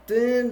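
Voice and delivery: high, drawn-out "ding"; imitated ding. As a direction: mimic a ringing hit. Imitates the helmet being hit.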